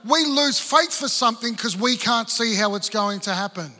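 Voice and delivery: full-force sermon delivery; preaching. Acted. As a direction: drive the point home to the congregation.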